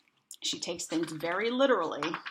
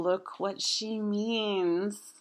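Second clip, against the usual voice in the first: usual speaking voice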